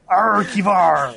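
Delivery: slow pirate-style "Arkivar" with rolled r; pirate accent. Shown as a demonstration. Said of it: "then he'd be a pirate".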